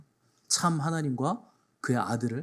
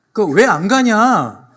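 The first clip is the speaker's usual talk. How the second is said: loud, higher and very slow blunt demand; blunt direct version; 6 semitones higher, 11 dB louder, much slower than usual. Retold or imitated. Pastor shouting the direct version to contrast the indirect joke, loud and slow. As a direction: bark the plain meaning out loud, slowly. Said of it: "그거를 이렇게 돌려치는 거죠".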